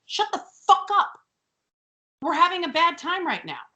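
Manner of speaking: high, hammed-up angry retort; fed-up, high-pitched comic protest; exasperated snap. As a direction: cut off the teasing with a mock outburst. Acted retort to the taunting twins.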